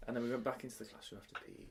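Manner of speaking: ordinary talk, nothing marked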